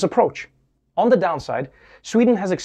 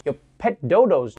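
ordinary talk

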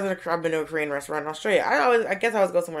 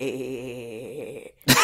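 nudging eh eh; 6 semitones lower, 6 dB quieter than usual. Retold or imitated. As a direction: tap your friend and go eh eh eh. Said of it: "they'll tap each other".

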